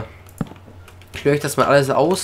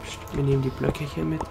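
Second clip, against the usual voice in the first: soft, secretive whispering; hushed whisper; 8 dB quieter than usual. Real, not acted. Player sneaking off with the blocks, hushed whisper. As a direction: keep it down as you sneak.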